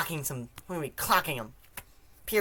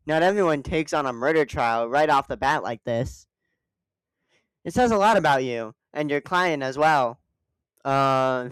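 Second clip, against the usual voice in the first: ordinary delivery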